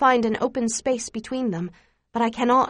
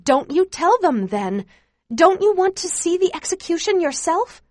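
raised, higher-pitched urging; urgent urging; 8 semitones higher, 4 dB louder than usual. A narrator's reading. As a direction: press the point insistently, pitch climbing. Acted quarrel, urging Chu.

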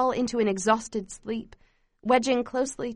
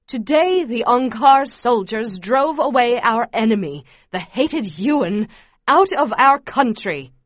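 loud, emphatic propaganda speech; fervent yell; 7 dB louder than usual. A narrator's reading. Camp leader reading news to the children, yelling fervently. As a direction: proclaim it forcefully to the whole crowd. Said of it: "Mebong yells out".

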